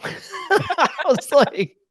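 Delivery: laughing through the words. Real, not acted. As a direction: giggle it out, high and slow.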